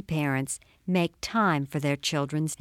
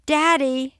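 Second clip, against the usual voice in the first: put-on high, louder little-girl plea; high-pitched pleading call; pleading child; 10 semitones higher, 6 dB louder than usual. A narrator's reading. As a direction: plead with Daddy like an eight-year-old. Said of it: "she pleaded".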